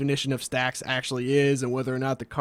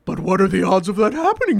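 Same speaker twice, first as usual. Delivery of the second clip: higher, louder, skeptical mock voice; smug viewer, mock-skeptical; mock viewer question; 5 semitones higher, 6 dB louder than usual. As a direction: sneer it as a doubting viewer.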